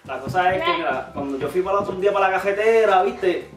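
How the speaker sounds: slangy Puerto Rican storytelling; casual Puerto Rican street chat; Puerto Rican accent. Acted. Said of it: "you didn't do a Puerto Rican".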